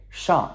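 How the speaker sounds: "Shang" high and loud, falling fast; descending fourth tone. Shown as a demonstration. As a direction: drop firmly through "Shang". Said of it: "descending tone".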